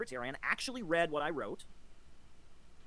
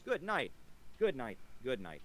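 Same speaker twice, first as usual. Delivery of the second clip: dismissive goodbye; 2 semitones lower, slower than usual. Acted. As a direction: wave the critic off, sinking each time. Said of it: "repeated dismissal falling lower".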